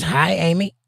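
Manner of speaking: ordinary talk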